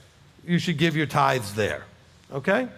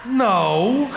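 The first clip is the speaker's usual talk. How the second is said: drawn-out, higher-pitched "no"; mock disbelief; 6 semitones higher, 4 dB louder than usual. Locals reacting to his Alabama joke, mock disbelief. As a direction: stretch it out as a teasing imitation.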